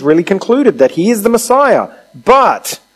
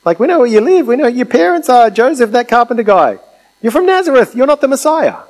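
mocking townsfolk voice, pitched higher, climbing as it goes; dismissive crowd; 7 semitones higher than usual. The crowd brushing off Jesus, a mocking higher scoff. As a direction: scoff at him like a know-it-all neighbour. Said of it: "these people are looking at Jesus and going".

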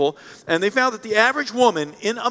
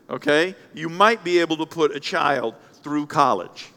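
usual speaking voice

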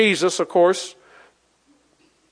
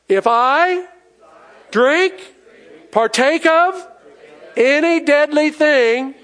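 rising, ringing pulpit declaration, drawn out; preacher leading the congregation to say it, preaching hard; preaching at full force; 5 semitones higher, slower than usual. Acted.

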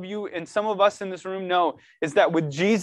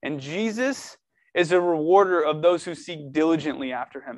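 ordinary talk

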